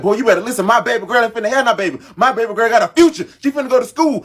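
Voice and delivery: high, loud scolding father's warning; dad shouting down the son over his daughter; imitating angry dad. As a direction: lay down the law like a furious protective dad. Dad scolding son in retold fight.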